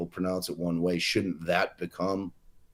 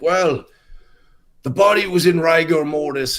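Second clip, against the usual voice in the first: higher, louder pronouncement as the expert; quoted expert witness; 7 semitones higher, 7 dB louder than usual. Retold or imitated. Pathologist answering in a deposition, imitated with a raised, emphatic voice. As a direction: declare it like an imitated expert on record. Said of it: "he says, it's, oh, he's like".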